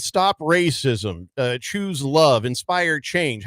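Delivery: very slow, each slogan drawn out; slow mock recital. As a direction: spell the slogans out slowly to mock them. Mockingly reciting the end-zone slogans.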